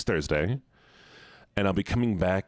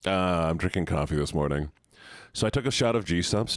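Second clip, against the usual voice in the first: normal talking voice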